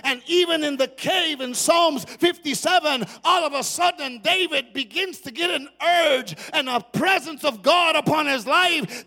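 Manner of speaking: loud, ringing sermon climax; preaching at full force. Acted. Preacher rising to a shout before the congregation. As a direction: preach it out over the music.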